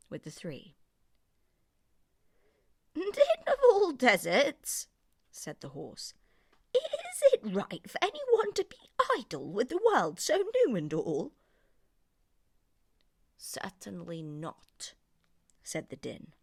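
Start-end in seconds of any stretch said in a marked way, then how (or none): 2.9-11.3 high, loud indignant horse voice; horse complaining. Acted. 13.4-14.9 desert spirit answering the horse slowly; din's verdict. Acted. Din replies.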